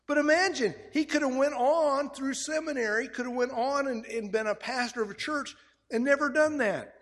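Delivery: high, loud, fervent preaching; full-force preaching. Acted. Pastor preaching to the congregation, high and fervent.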